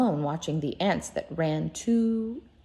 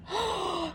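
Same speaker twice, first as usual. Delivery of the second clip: mock gasp; 11 semitones higher, 5 dB quieter than usual. Rabbit shocked at missing legs.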